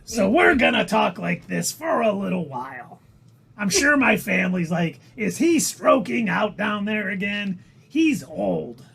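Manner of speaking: exaggerated celebrity impersonation, drawing out key words; Gilbert Gottfried. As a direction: announce it like an abrasive comedian. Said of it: "if I kept doing this impersonation".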